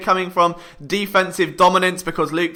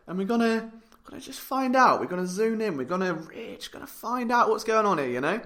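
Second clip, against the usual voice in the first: much softer, drop away as if peering closely; hushed peering; 9 dB quieter than usual. Pretend. Host peers into helmet as crystal ball, hushed mock searching.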